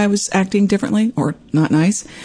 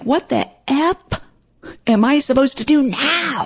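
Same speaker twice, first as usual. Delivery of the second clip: high-pitched retold outburst; super pissed; 10 semitones higher than usual. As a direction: act out losing it on the phone. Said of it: "I got super pissed".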